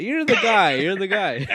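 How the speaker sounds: loud, high-pitched teasing jab. Real, not acted. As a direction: tease him loudly, close to laughing. Teasing the co-host, laughing.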